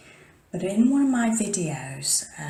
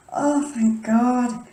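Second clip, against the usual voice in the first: relieved; 7 semitones higher, 7 dB louder, much slower than usual. Real, not acted. Relief, slowed.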